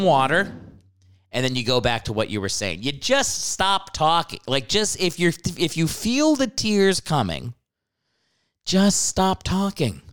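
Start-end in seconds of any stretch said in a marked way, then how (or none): none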